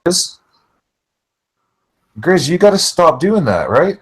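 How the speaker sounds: choppy, cutting-out audio; radio breaking up. Real, not acted. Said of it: "sounds like he's breaking up".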